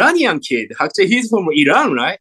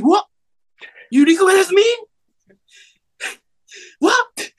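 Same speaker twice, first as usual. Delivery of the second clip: retold surprise; 13 semitones higher than usual. Neighbor kid recognized him.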